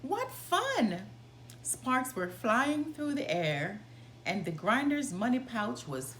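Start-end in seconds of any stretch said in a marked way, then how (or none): none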